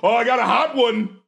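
high, loud, laughing glee; hammed-up delight with a chuckle; mock eager joker. Imitating someone eager to joke about an attack, gleeful.

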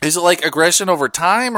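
normal talking voice

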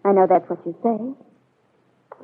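bitter disbelief. Acted. Peggy doubting Bill's excuses.

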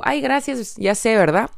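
relaxed playful thanks; breezy cheeky thanks. Shown as a demonstration. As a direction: toss it off calmly. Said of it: "yo digo".

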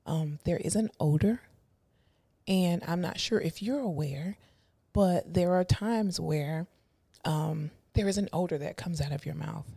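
hushed, fake-sweet voice; condescending fake-nice voice. Shown as a demonstration. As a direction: talk down softly, pretending kindness. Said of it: "that voice".

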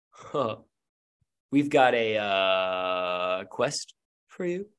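slow, halting delivery with a drawn-out uh; stall, then offer it uncertainly; unsure, stretching the words out; hesitant shop boy. Acted. Game master voices hesitant shop boy.

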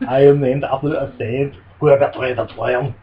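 lightly hammed-up Arnold introducing himself; host mimics Arnold playing Simon Templar, heavy mock accent; Arnold as the Saint.

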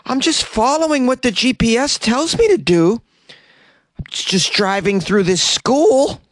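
high, loud airhead falsetto; Florida woman voice. Retold or imitated. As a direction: play the clueless driver innocently. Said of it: "The Florida woman said".

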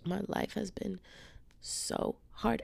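whiny, breathy sob-story voice, fading to a whisper; mock self-pity. Mocking her old self for moping listeners. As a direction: pretend to wallow in your woes. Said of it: "a podcast where I'm like".

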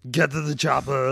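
mock Austrian action-star voice; Arnold Schwarzenegger. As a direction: imitate a thick-accented action hero. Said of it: "That was very Schwarzeneggerian".

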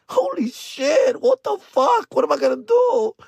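hammed-up tearful panic; mock despair. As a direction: whimper helplessly in falsetto. Despairs over lost money.

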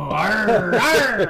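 loud, high hammed-up pirate arr; pirate growl. Reading the ghost list, hamming up a loud pirate arr. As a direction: bellow arr like a cartoon pirate. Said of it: "do a pirate voice".

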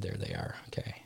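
breathy whispered aside. Real, not acted. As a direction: whisper it under your breath. Aside while pulling up wheel.

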